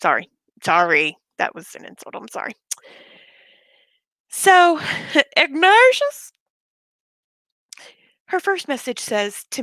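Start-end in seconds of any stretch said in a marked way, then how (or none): 1.4-2.5 whispered aside; under the breath; whispering. Real, not acted. Muttered aside after name slip. 5.4-6.3 high loud call to a pet. Real, not acted. Calling out to her cat.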